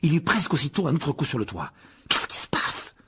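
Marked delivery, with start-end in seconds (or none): 2.1-2.9 unvoiced, breathy gasp; hushed alarm; frightened whisper. Ron terrified by second blow.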